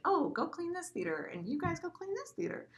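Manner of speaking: high, singsong delegating voice; bossy head usher. Retold or imitated. Head usher sending staff to clean theaters, high singsong orders. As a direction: hand out orders in a high, light voice.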